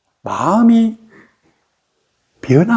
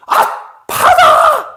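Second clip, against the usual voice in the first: high exclamation of sudden awakening; much higher acted yell; retold shout; much slower than usual. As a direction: cry it out like a revelation hitting. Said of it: "이렇게 소리를 지르는 거야".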